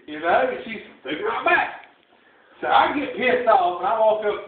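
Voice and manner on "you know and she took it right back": ordinary delivery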